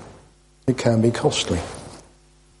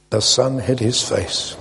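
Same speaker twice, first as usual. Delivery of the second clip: ordinary delivery, nothing marked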